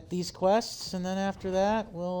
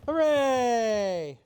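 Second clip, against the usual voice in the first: cheering; 5 semitones higher, 4 dB louder than usual. Real, not acted. Streamer cheers at game moment.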